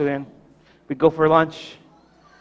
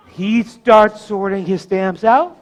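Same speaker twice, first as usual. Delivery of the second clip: usual speaking voice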